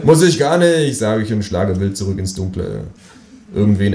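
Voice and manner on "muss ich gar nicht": put-on 1 childish retort. Childish quarrel, hitting back.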